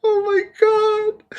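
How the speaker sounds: high, drawn-out, weak with laughter; laughing exclamation. Real, not acted. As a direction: sigh it out high through laughter. Recovering from laughing fit.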